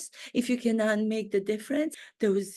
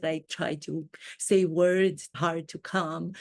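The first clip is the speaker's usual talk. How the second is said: usual speaking voice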